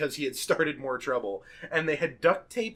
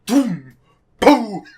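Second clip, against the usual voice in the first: loud booms, the second higher; explosion noises; 6 semitones higher, 12 dB louder than usual. Retold or imitated. Mike firing fireworks from his backyard, imitated blasts. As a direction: imitate mortar shots going off, punchy and separate. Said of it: "he's just like".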